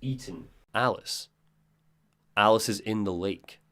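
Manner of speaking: low, soft, grave horror line; ominous storytelling. Acted. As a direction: repeat it softly and gravely to spook them.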